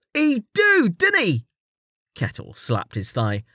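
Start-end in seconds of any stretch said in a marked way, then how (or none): 0.1-1.5 much higher, cheeky rough-edged mate ribbing his friend; Kettle's high teasing voice. A narrator's reading. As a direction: tease him in a high jokey voice.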